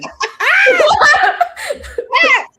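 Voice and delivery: a shrieking high laugh that bursts out; loud laugh. Real, not acted. Host bursting out laughing at the roast, loud high laugh.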